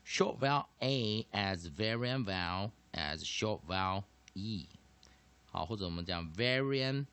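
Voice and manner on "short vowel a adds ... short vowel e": "Short vowel A adds variant vowel adds short vowel E" read slowly, word by word; slow formula recital. Shown as a demonstration. Teacher reading the formula to students, slow and deliberate. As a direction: spell the formula out slowly for the class. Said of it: "我们的公式叫什么".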